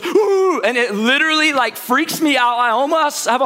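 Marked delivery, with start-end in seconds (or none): 0.0-0.6 dog's startle woof. Retold or imitated. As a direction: bark it out like a dog popping up. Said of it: "this dog ... just goes".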